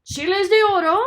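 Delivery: loud, greedy question; hungry curiosity; eager giant. Acted. Giant hearing of golden chiles, eager. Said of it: "asked El Gigante Terrible".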